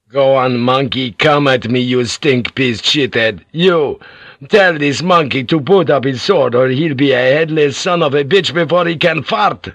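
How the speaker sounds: loud, crude sailor's snarl; a rough bosun threatening the samurai; bosun's threat. A narrator's reading. Bosun taunts with pistols.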